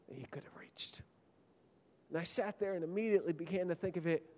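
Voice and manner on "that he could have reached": hushed. Real, not acted. Preacher drops to a hush.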